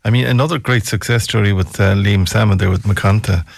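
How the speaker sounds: usual speaking voice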